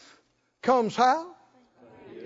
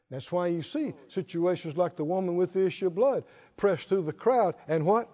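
ordinary delivery

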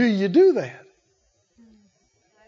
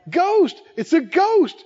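frightened cry; 7 semitones higher than usual. Acted. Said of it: "they cried out for fear".

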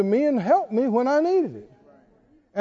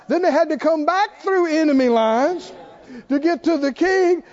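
building sermon preaching; 4 semitones higher than usual. Acted. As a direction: pile on the drama as the men cross enemy lines. Preaching the rescue story.

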